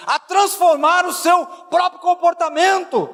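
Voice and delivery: high-pitched, driving exhortation; fervent preaching. Real, not acted. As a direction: urge the congregation on, pressing each phrase. Preaches at raised voice.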